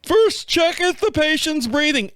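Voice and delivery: high, slow, hammed-up novice dispatcher; clueless first-day dispatcher voice; rookie 911 dispatcher. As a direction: read it off the flow chart, high and slow. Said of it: "like a nine one one dispatcher on his first day".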